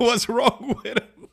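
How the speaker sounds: high, slow, laughing through the quote. Retold or imitated. Quoting her confused reaction while laughing, high and slow. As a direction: ask it while cracking up. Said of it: "She was like".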